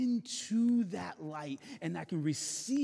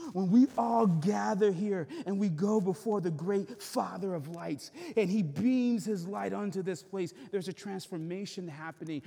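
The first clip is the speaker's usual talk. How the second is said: fervent preaching; 5 semitones higher, 7 dB louder than usual. Acted. Pastor rises on gathering.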